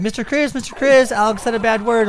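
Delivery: put-on high, eager kid voice tattling; sing-song tattle of a small girl; little girl tattling. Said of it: "she ran and was like".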